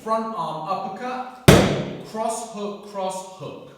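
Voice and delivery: loud, punchy combo calls; boxing coach calling combos. Shown as a demonstration. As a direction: call each punch as it lands. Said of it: "calling the full combo run".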